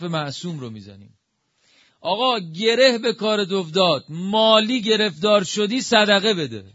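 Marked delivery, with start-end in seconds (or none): 2.0-4.0 emphatic preaching, louder. Real, not acted. Preacher urging the congregation to give charity, loud and high. Repeats the advice, now loud. 4.1-6.6 loud, high preaching, slowing down; forceful exhortation. Real, not acted. Preacher pressing listeners to give charity, loud and high.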